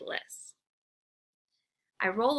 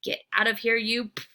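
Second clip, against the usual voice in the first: fierce bark; 4 dB louder than usual. Acted. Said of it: "I bark in my fiercest voice".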